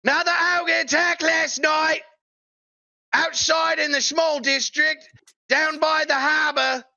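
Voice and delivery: high-pitched, drawn-out newsboy cry; stretched, high street-seller's holler; newsboy yelling out. Acted. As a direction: call the headlines down the street, slowly. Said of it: "Yelling out".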